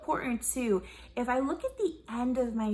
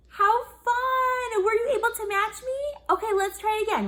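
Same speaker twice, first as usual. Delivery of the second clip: high, loud bright praise; delighted praise; 11 semitones higher, 6 dB louder than usual. Acted. Music teacher praising the class, high and loud. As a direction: cheer the kids on.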